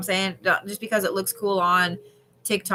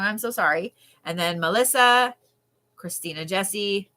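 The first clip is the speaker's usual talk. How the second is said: ordinary talk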